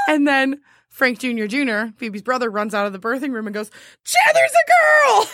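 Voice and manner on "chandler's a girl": very high, slow, loud yell; excited shout. Retold or imitated. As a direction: burst out shouting the news. Character runs out yelling.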